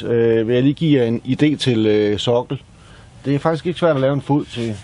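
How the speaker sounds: ordinary talk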